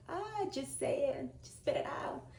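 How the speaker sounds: high, slow urging, trailing into a whisper; pep talk to herself. Retold or imitated. Urging herself to tell mom, high slow whisper. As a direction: egg yourself on, high and drawn out. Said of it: "I was like".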